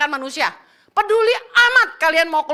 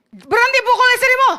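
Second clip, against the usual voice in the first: loud, sharp command to stop; stern shout; 6 semitones higher, 4 dB louder than usual. Retold or imitated. Preacher shouting at a man hitting his wife, a firm loud order. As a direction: bark the order at him.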